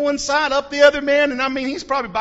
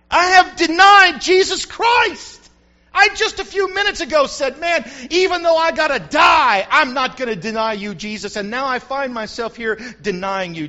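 louder, higher anguished cry; 5 semitones higher, 7 dB louder than usual. Acted. Peter realizing he denied Jesus, anguished loud cry. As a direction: cry out in remorse.

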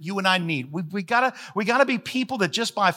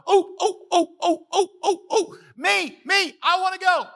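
much higher, breathless with a laugh; a kid begging to be picked; eager Isaiah; 11 semitones higher than usual. Retold or imitated. Isaiah eagerly volunteering to God, high excited pleading. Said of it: "And Isaiah's going".

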